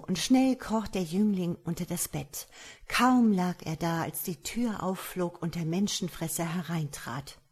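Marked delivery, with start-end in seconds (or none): none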